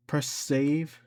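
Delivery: normal talking voice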